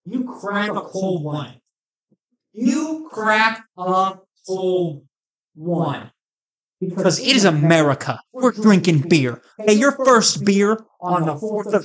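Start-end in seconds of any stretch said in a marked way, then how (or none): 0.1-6.1 slow savouring. Acted. Savouring beer moment.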